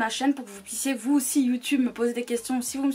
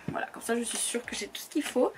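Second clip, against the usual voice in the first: whispered aside to herself; whispering; 6 dB quieter than usual. Real, not acted. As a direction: breathe it out as a quick whisper. Aside while handling makeup palettes.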